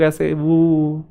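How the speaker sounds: slow drawn-out mock boo; booing demo. Shown as a demonstration. Teacher showing students how a crowd boos, drawn-out boo. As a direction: stretch the बू out like booing a performer. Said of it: "बू ऐसे करते हैं ना हम लोग".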